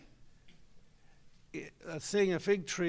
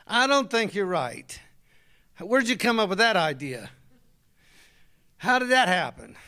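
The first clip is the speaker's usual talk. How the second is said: doubting husband; 7 dB louder than usual. Retold or imitated. Husband doubting his wife out loud. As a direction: pour cold doubt on her hopes.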